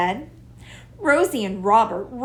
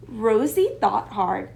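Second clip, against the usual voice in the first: ordinary delivery, nothing marked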